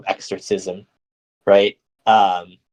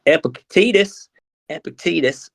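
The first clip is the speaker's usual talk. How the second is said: hammed-up American accent; 8 semitones higher than usual. Shown as a demonstration. Speaker exaggerating a name they can't pronounce, hammed American accent. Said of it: "putting on a ridiculous American accent".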